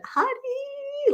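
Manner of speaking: high sing-song call of a name. Real, not acted. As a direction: call her name across the room, drawn out. Calling co-host on muted video.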